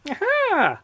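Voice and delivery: very high, smiling little aha; smiling goblin. A narrator's reading. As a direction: pipe a sly goblin aha. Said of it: "smiled the goblin".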